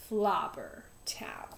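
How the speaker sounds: dropped to a low hush; whispered. A narrator's reading. Mister Lowe hushing the dreaded town name, whispered. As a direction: breathe the name like a curse.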